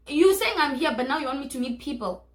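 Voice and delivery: loud, incredulous pushback. Retold or imitated. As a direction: call out the nonsense, loud and disbelieving. Annoyed quote at boyfriend.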